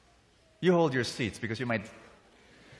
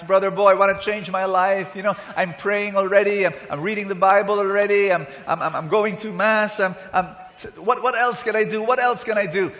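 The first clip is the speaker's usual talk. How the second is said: higher, louder imitation of a pleading follower; retold desperate plea; 5 semitones higher, 5 dB louder than usual. Tearful listeners come up to Brother Bo after his talk, retold desperate plea. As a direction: plead as if running out of options. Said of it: "tears in their eyes".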